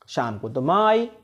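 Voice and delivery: ordinary delivery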